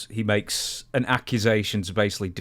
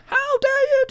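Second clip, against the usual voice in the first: shrieked way up high, much louder; Kevin Kelly's squeaky scream; 6 dB louder, slower than usual. Retold or imitated. Host mimics the wrestler yelling at his boss, sky-high shriek. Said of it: "screaming at a very, very high pitch".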